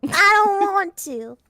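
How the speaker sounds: high, drawn-out southern whine; mock-pouting southern belle twang; southern accent. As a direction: protest in a slow Southern drawl. Keeps southern accent while protesting.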